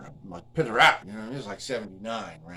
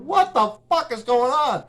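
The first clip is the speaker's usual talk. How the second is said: outraged; 10 dB louder, slower than usual. Retold or imitated. Retelling outrage at the album version.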